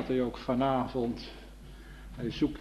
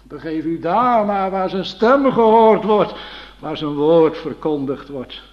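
fervent urging; 4 semitones higher, 9 dB louder than usual. Acted. Preacher urging hearers to the Word.

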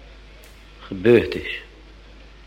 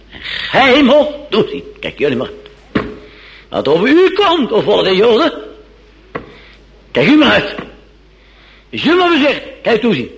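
Pilate warning; 9 semitones higher, 4 dB louder than usual. Acted. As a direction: hurl the warning at the crowd. (Pilatus waarschuwt de Joden hoog en luid)

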